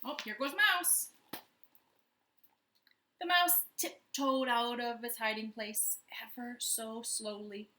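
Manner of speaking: ordinary delivery, nothing marked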